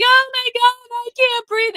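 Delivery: high-pitched imitation of tearful pleading; mock crying. Streamer mocking the arrested woman's plea, mock crying. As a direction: put on a high, whiny sob voice. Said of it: "because she's crying like".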